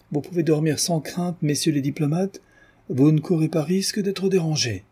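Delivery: high-pitched, teasing put-down; mocking lecture. A narrator's reading. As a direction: reassure them with pointed irony. Countess mockingly lectures the diplomats. (leçon moqueuse aux diplomates)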